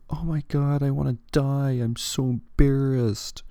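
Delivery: put-on melodramatic embarrassed teenager; angsty teen. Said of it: "That was my impression of a angsty teen".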